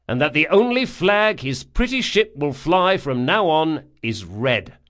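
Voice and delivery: loud, defiant message; defiant captain. A narrator's reading. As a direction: send a cocky message. Locke's message to Archon.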